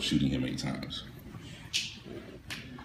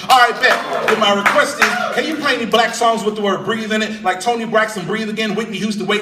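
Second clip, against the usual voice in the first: mock caller; 8 dB louder than usual. Caller voice, mock radio request.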